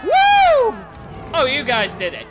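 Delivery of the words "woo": very high, loud woo; celebratory whoop. Real, not acted. Player cheering the team's success, loud high whoop. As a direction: let out a piercing cheer.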